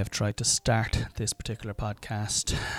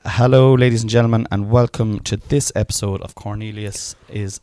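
hammed-up announcer; 8 dB louder than usual. Introducing his wife as podcast host, loud mock announcer. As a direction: announce the host like a game show.

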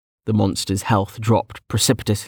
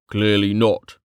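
mild derision; slower than usual. A narrator's reading. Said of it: "Officer Vink said derisively".

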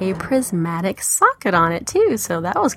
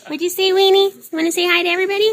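pet talk; 12 semitones higher, much faster than usual. Real, not acted. Talking to the pet Weenie.

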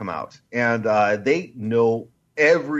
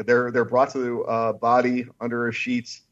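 ordinary delivery

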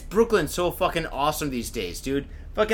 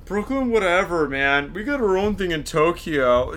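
mocking hipster. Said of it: "do I sound like a fucking".

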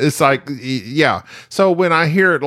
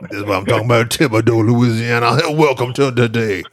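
put-on Louisiana accent; 4 semitones lower than usual. Radio host mimicking a caller, Louisiana accent. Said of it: "we had a caller from Louisiana".

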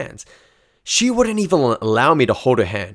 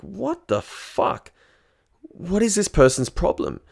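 much higher, slow, incredulous; baffled outrage; 9 semitones higher, slower than usual. Retold or imitated. Ignored by his date, he asks himself, high and incredulous. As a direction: stretch it out high in disbelief. She turns her back on him.

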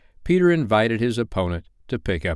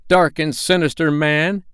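high, youthful heroic voice; high, declamatory defiance; Peter; 7 semitones higher, 4 dB louder than usual. A narrator's reading. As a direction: challenge the villain boldly, pitched up. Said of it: "Peter answered".

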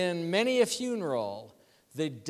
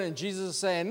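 normal talking voice

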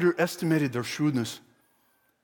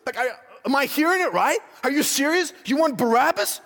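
high-pitched, disbelieving questions; incredulous; 6 semitones higher than usual. Acted. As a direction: question them as if you can't believe your ears. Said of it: "like, he can't believe it".

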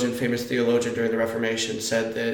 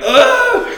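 high, loud mock scream of alarm; short burst of comic fright; jolted awake, cry out; startled yelp; 9 dB louder than usual. Retold startled reaction to the screaming.

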